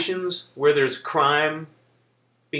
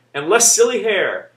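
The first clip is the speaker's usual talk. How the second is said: ordinary talk, nothing marked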